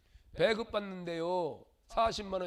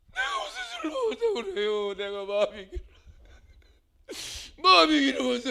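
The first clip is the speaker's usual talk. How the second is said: Hannah pleading; 4 semitones higher, 9 dB louder than usual. Acted. Hannah answers Eli, distressed.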